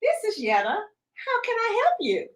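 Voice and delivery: sweet, high phone greeting; bright professional phone voice; customer service voice. Retold or imitated. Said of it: "put on my customer service voice".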